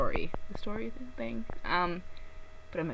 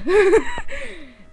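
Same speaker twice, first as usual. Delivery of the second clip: loud high laugh; 12 dB louder than usual. Real, not acted. Laughs at own confession.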